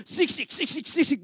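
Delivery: imitated huffing and puffing; angry huffing. Naaman fuming at the prophet's message, huffing angrily. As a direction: fume in quick mock huffs. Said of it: "막 화를 냈어요".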